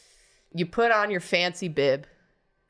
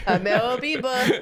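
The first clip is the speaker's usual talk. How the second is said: laughing; 6 semitones higher than usual. Pretend. Repeats her catchphrase, laughing.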